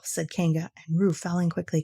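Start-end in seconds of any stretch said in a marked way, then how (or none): none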